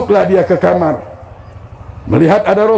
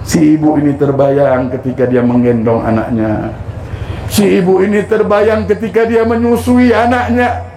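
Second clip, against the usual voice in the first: building emotional narration; 5 semitones higher than usual. A narrator's reading. Mother recalls raising her son.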